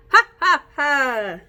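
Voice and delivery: high, loud laughing ha ha; loud hyena laugh. Shown as a demonstration. Teacher cues the hyena laugh, loud and high. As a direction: cackle like a hyena. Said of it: "we're gonna make the laugh of".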